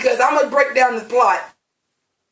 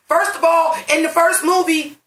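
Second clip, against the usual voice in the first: heated rant; 8 semitones higher than usual. Real, not acted. Ranting about plot rules.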